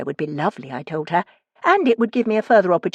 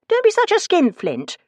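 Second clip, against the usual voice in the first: mock scolding; 3 dB louder than usual. Teasing landlord about gutter.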